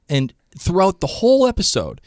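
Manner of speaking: ordinary delivery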